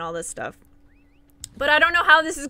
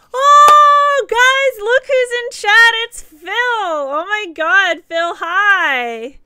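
much higher and louder, thrilled shout-out; excited greeting; 10 semitones higher, 11 dB louder, much slower than usual. Real, not acted. Old mod shows up in chat.

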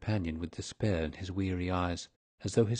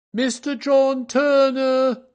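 very high, loud, drawn-out call; waiter's announcing cry; 11 dB louder, much slower than usual. A narrator's reading. Hotel waiter announcing a visitor, high ringing cry. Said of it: "cried the hotel waiter".

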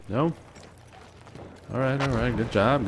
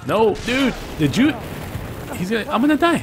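very high, loud panicked cry; 8 dB louder than usual. Real, not acted. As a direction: shriek as you're about to die. About to die in game.

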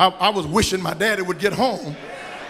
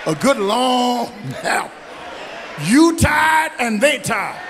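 preaching build; 5 semitones higher than usual. Acted. Pastor telling the church about discipline, preaching. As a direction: build the point to the crowd.

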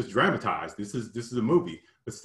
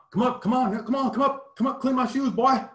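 much higher, taunting Southern voice; big country accent; 14 semitones higher, 3 dB louder than usual. Retold or imitated. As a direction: sneer it out in an exaggerated country accent. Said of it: "putting on this, big time, uh, country accent".

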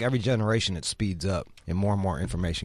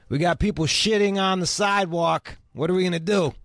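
mocking disbelief; 8 semitones higher than usual. Real, not acted. Mocking San Francisco's straw ban.

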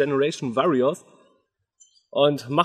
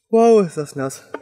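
loud, slow, shocked by the cold; in ice cold water; 3 semitones higher, 7 dB louder than usual. Real, not acted. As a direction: gasp it out, freezing. Just drenched in ice water.

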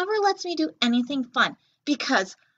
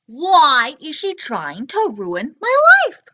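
put-on louder, slow, outraged kid reading; dramatic complaint; 2 semitones higher, 10 dB louder, slower than usual. A narrator's reading. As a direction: wail it like a wronged child. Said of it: "book's child complains".

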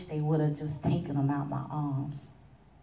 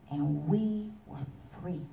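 usual speaking voice

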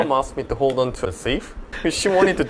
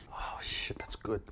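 breathless whisper of delight; 4 semitones lower, 17 dB quieter, slower than usual. Real, not acted. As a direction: whisper it, amazed. Tasting noodles quietly.

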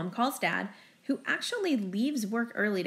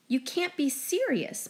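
high, slow disbelief; exclaiming; 7 semitones higher, slower than usual. A narrator's reading. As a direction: exclaim it in shock. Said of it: "my mother exclaims".

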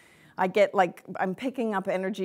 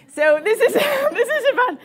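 talking through laughter, high and loud; helpless laugh breaking up the words; 12 dB louder than usual. Real, not acted. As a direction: crack up mid-sentence and try to carry on. Cracks up at own story.

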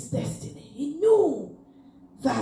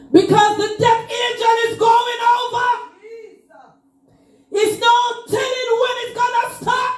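full-force preaching; 9 semitones higher than usual. Acted. Preacher calling the congregation to rise, sung-out shouting.

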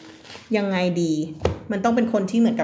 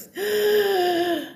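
gasp; 11 semitones higher than usual. Acted. Story spaceship reaches Earth.

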